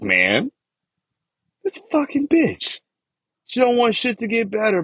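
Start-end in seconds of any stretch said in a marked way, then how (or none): 1.6-4.8 venting. Real, not acted. Venting anger about his mom.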